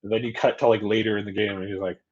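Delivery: ordinary delivery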